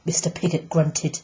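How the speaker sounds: ordinary delivery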